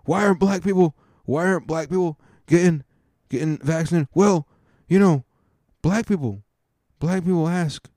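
rambling politician impression, pitched high; halting, hyperventilating mock president with long pauses; Joe Biden. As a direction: lose the thread in a high, put-on voice. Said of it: "Joe Biden was asked questions and he hyperventilate".

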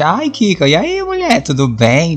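high chirpy hello to a friend; a bright high greeting to Kika; casual friendly greeting. Retold or imitated. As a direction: greet her brightly, as if it's normal. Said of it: "E eu já agia naturalmente".

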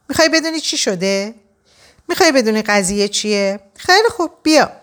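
high, exasperated outburst; angry yell. A narrator's reading. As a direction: lose your temper and raise your pitch. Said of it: "داد زد".

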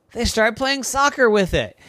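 plain reading voice

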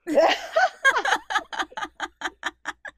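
loud high laughing fit; cracking up out loud; helpless loud laugh; big burst of laughter. Real, not acted. Laughing at daughter's punchline.

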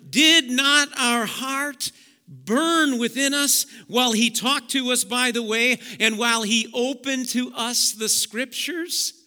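high, slow, glowing question; awed wonder. Acted. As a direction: marvel at a burning heart. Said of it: "they said to one another".

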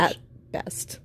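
unvoiced dry aside at best; whispered aside. Real, not acted. Host mutters a dry joke about their Spanglish, whispered.

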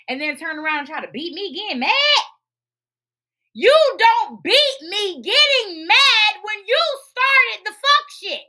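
high, loud furious rant, half laughing. Real, not acted. To her dad who got mad, a furious rising rant. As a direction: shout it out, near tears and laughing. Ranting about dad's anger.

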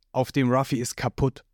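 ordinary delivery, nothing marked

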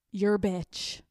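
normal talking voice

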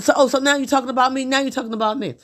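high, fast indignant mimic of her husband; mock defensive husband. Imitating her husband on the phone, high and indignant. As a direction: throw his whiny accusation back.

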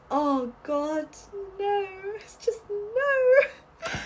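high, drawn-out dismayed "no"; cringing dismay. Real, not acted. Cringing at bad CGI effect.

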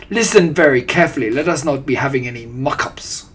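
put-on stern, accented warning; domineering villainess; Grand High Witch. A narrator's reading. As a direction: order them to pay attention, starting fast and loud. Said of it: "snapped the Grand High Witch".

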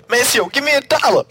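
high, loud sung-style shout. Retold or imitated. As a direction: call it out like a bandleader. Quoting the song's line.

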